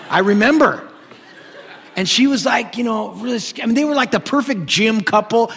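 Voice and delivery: chuckling through the description; laughing while picturing the gym couple. Real, not acted.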